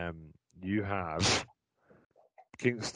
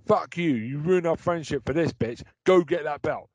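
mock angry shout; 3 semitones higher, 9 dB louder than usual.

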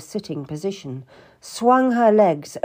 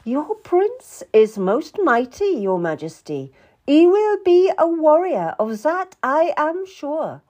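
high-pitched royal assurance; proud queen to the king; 9 semitones higher, 5 dB louder than usual. A narrator's reading.

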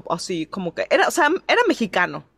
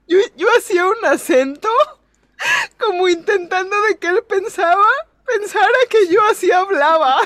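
much higher, louder, through laughter; laughing; 10 semitones higher, 4 dB louder than usual. Real, not acted. Telling friends her cover-up, laughing hard.

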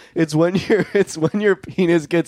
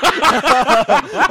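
loud laugh; 13 semitones higher, 6 dB louder than usual. Real, not acted. Laughing at comment.

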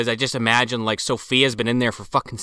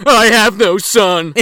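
loud, higher, slow wailing declaration; melodramatic outcry; 7 semitones higher, 9 dB louder, slower than usual. Acted. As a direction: ham it up like a tragic film parent. Movie quote gag among friends.

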